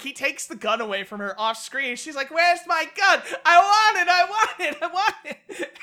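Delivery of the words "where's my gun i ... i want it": put-on loud, high drunken whining; hammed-up drunk tantrum; drunken stupor. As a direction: imitate a drunk demanding it back like a toddler. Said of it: "in this drunken stupor".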